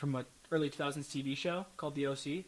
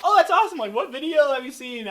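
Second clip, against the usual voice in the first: much higher and louder, bursting with delight; thrilled hello to a fan who spotted him; eager greeting; 9 semitones higher, 8 dB louder than usual. Retold or imitated. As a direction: greet the fan like they made your day. Greeting fans who recognize him.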